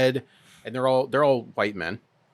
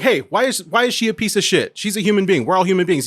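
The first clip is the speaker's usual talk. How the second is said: higher, louder mock confrontation; retold confrontation; 8 semitones higher, 6 dB louder than usual. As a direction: stand up to him, raised voice. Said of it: "I'm like".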